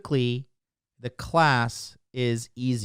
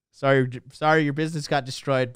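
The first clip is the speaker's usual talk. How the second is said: usual speaking voice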